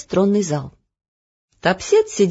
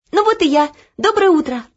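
higher and louder, bright greeting; cheerful girl; 9 semitones higher, 5 dB louder than usual. Acted. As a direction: Olya springing out of bed. Olya jumps up cheerful. (радостно)

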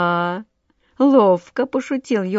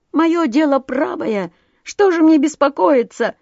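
much higher, louder, indignant; outraged yell; 7 semitones higher, 4 dB louder than usual. A narrator's reading. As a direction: bellow back in disbelief. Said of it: "орал Воробей Воробеич".

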